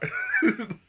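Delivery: short squeaky giggle; high laugh. Real, not acted. Amused at not knowing where.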